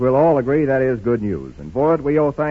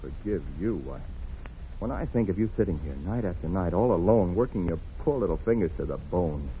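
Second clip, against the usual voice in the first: tender; 8 semitones lower, 6 dB quieter than usual. Acted. Husband reconciling with wife.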